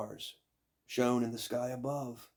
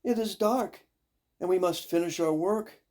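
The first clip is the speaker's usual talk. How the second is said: higher, louder fretful complaint; stepsisters complaining; 8 semitones higher, 6 dB louder than usual. Acted. Stepsisters complaining about the dark, high and loud. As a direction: whine that they can't see to work. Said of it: "cried the stepsisters".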